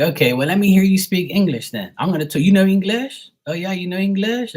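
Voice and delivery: high, loud taunting questions; mock challenge. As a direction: turn the tables with a needling challenge. Acted challenge to the mockers.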